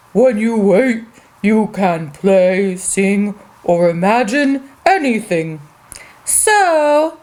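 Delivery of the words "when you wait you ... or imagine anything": put-on a calm, lowered dad telling the kids; daddy voice. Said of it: "He says".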